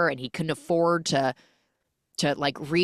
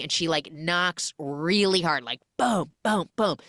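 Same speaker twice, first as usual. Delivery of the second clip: ordinary talk, nothing marked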